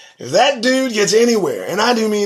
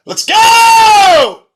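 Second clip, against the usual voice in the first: big drawn-out shout of let's go, higher and louder; calling out; 13 dB louder than usual. Real, not acted. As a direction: call the whole crowd in. Calling everybody to gather.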